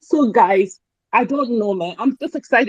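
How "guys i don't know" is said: ordinary talk, nothing marked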